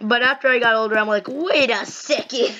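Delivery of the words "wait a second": slow, drawn-out suspicion; dawning realisation. Retold or imitated. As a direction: stretch it out as the truth dawns. Retold dawning realisation about cartoon.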